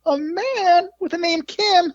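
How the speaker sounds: very high squeal of put-on disbelief; mock astonishment. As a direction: feign shock, drawn out and sky-high. Mock astonishment in retold promo.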